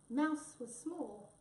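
ordinary delivery

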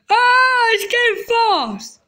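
high, loud excited cry. Real, not acted. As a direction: yell it as you pick up speed. Speeding down the slide.

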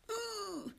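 sharp high yelp at the sting; high pained ooh. Real, not acted. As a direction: squeal as the waterline burns. Eyeliner burning her waterline.

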